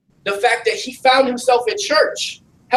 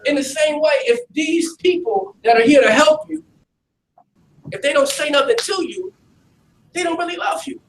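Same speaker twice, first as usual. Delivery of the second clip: raised, higher, forceful preaching push; 6 semitones higher than usual. Acted. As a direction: lean in and preach it hard to the youth. Preacher's rising exhortation.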